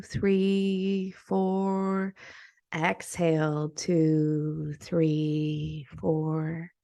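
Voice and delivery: soft, unhurried meditation voice with long pauses; guided meditation. Acted. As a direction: guide them gently through each breath, counting in drawn-out words.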